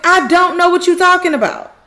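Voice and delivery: high, loud retold protest; clear insistent denial. Karen clearly telling Zach, loud insistence. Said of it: "clearly telling him".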